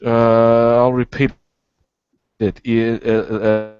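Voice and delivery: choppy dropping-out audio; breaking up connection. Real, not acted. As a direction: signal cutting in and out. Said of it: "You're really breaking up".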